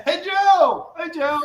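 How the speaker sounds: imitating passers-by hailing an American; locals calling out. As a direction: sing out the greeting from a passing car. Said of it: "and people pass you".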